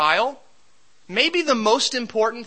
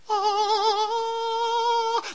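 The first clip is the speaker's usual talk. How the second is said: sung ah; 14 semitones higher than usual. Pretend. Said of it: "I would want to tell people".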